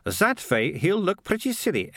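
a lightly voiced confident girl; high girl's voice, Malicia. Malicia explains her plan to Morris, lightly voiced confident girl. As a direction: pitch it up, pleased with herself.

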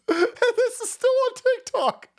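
high laughing incredulity; laughing disbelief. Real, not acted. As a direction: laugh while calling it crazy.

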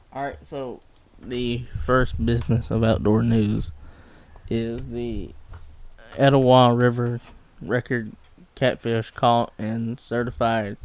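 ordinary talk

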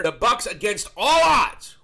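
slow, loud and emphatic, like calling a big upset; hype-man announcement of an upset; excited shout. Real, not acted. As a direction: belt out the surprise win. Excited recap of upset win.

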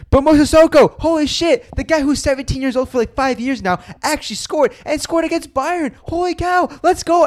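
très aigu, emballé, fort sur la fin; excited. Real, not acted. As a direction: crie ta joie, voix qui monte très haut. Excited about surprise late goal.

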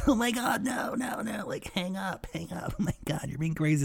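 high, hushed squeal of friends egging him on; mock giggly protest. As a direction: imitate giggling friends begging him to stop. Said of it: "all laughing".

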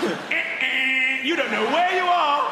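high, loud buzzer then taunt; mock quiz buzzer. Quizzing a stranger on geography, mock buzzer taunt. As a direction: buzz them out like a quizmaster.

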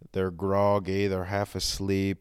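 normal talking voice

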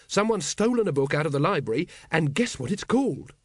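high, eager reveal; triumphant. Acted. Carrot reports the stolen book to Vimes, triumphant. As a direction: proudly build up to the big discovery. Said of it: "said Carrot triumphantly".

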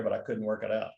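normal talking voice

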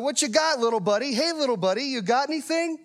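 put-on high playful voice for a little boy; talking to a toddler. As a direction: coo at a toddler son. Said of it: "when I'm talking to my son".

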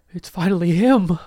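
high, loud, reverent; awed high voice. Pretend. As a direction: gasp it out in awe. Congregation awed at arrival.

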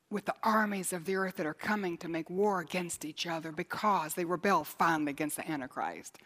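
ordinary talk, nothing marked

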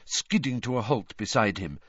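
ordinary delivery, nothing marked

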